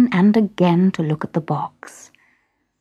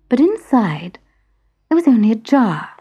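ordinary delivery

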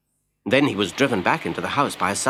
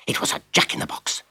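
ordinary delivery, nothing marked

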